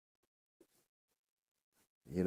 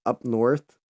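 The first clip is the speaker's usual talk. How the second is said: ordinary delivery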